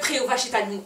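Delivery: ordinary delivery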